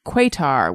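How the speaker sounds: "Qatar" as KWAY-tar; "Qatar" starting like quake, ending in tar; childhood pronunciation. Shown as a demonstration. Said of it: "I grew up with it being called".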